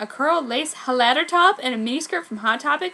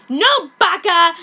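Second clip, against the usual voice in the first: loud, slow indignant snap; angry rebuff; 7 semitones higher, 9 dB louder, slower than usual. Acted. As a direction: push off a creep. Rebuffing a pervert.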